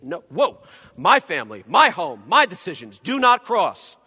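a believer loudly guarding his family and home; possessive outburst. Retold or imitated. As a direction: stake your claim loudly. Believer snapping back possessively.

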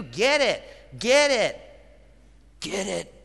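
fed-up lecture that builds to insistent demands; ticked off. Retold or imitated. Parent fed up with repeating lessons to older kids, ticked off. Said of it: "don't be so ticked off".

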